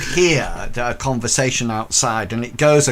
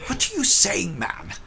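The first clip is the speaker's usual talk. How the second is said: incredulous inspector; 11 semitones higher than usual. Acted. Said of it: "And he goes".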